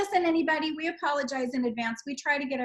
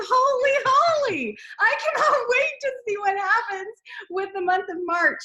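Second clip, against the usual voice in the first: excited; 7 semitones higher, 5 dB louder than usual. Real, not acted. Gushing over team numbers.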